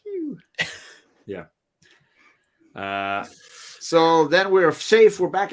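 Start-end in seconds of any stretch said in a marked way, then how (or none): 0.0-0.4 high mock blaster noise; ray gun pew. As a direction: squeak out a zap sound.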